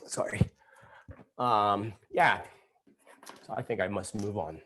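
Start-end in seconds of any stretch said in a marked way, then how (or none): 0.1-0.4 hushed apology under the breath; sheepish whisper; whispered sorry. Real, not acted. Apologizing after the outburst.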